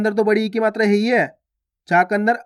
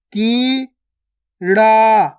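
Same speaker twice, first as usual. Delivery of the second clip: कीड़ा split as kee, pause, raa; syllable by syllable; slower than usual. Shown as a demonstration. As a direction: slowly sound out कीड़ा in two held syllables. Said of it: "direct मात्रा का उच्चारण करके पढ़ेंगे". (कीड़ा को तोड़कर, की... ड़ा)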